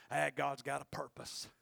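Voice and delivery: ordinary talk, nothing marked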